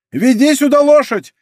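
loud, high bellowed order; thunderous shout. A narrator's reading. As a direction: shout it thunderously across the yard. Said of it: "крикнул Планше громовым голосом".

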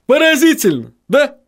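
very high-pitched, pleased character voice; gleeful high character voice; smirking. A narrator's reading. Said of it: "ухмыльнулся господин Винтлер".

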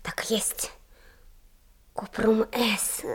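soft, slow whisper to herself; whispering while being questioned. Acted. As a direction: murmur the words so nobody hears. Muttering under her breath.

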